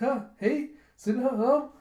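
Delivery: high, loud confused calling; startled protest. Retold or imitated. Girl calls Sinha as he takes the sword, startled. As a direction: call out, taken aback.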